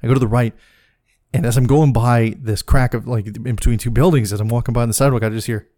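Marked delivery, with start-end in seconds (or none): none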